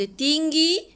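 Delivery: high pitch demo. Shown as a demonstration. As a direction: lift "ada tinggi" up high and loud to show a high tone. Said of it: "nada suara tu ada tinggi".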